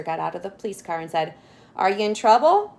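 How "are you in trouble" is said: a stern-ish officer calling out; policeman asking. Acted. Policeman asks the stopped family if they need help.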